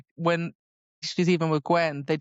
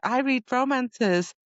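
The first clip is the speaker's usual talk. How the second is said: shy admission; 7 semitones higher, slower than usual. Retold or imitated. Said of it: "Emery's like".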